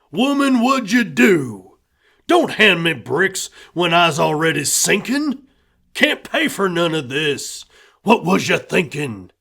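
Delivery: angry husband. A narrator's reading. Husband scolding his wife over money, loud and exasperated. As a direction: scold her for bringing home another cost.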